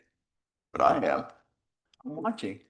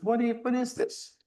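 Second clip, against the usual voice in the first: retold incredulous question; 14 semitones higher than usual. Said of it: "I says".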